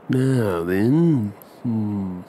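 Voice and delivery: ordinary talk, nothing marked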